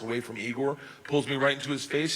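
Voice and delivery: usual speaking voice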